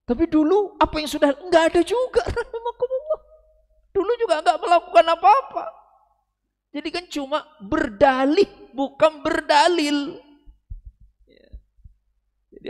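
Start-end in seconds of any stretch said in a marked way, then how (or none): 0.1-10.2 louder, higher teasing scolding; mocking rebuke. Real, not acted. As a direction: laugh at the excuse while scolding. Sindiran lantang kepada jamaah. (menyindir keras sambil tertawa)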